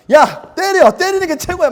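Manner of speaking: excited shout. Retold or imitated. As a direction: yell it like gospel to friends. Retelling bragging to friends. (친구들에게 신나게 외치는 자랑)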